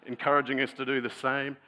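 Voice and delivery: normal talking voice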